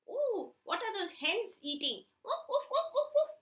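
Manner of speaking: high excited puppy voice with barks; high yapping puppy, laughing; curious puppy. Acted. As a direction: chatter and yap like a nosy pup.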